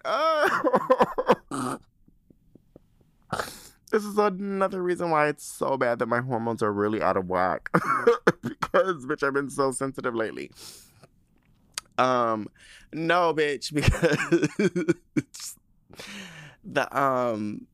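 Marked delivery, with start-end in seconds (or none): 0.0-1.8 high, loud "uh" breaking into laughter; near-crying laugh. Real, not acted. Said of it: "bitch, I'm gonna start crying". 7.7-8.7 high, loud burst of laughter; helpless laughter. Real, not acted. Laughing at own sensitivity. 13.8-14.9 high, loud giggling burst; laughing mid-sentence. Real, not acted.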